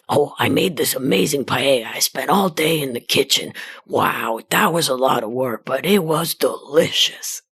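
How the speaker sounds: a hint of her proud dad bragging about his cooking; imitating dad. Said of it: "he'll go".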